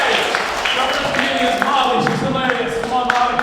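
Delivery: loud, high host's call; hyped MC introduction. Real, not acted. Host brings the first comedian on stage, hyped up. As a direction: whip up the crowd for the act.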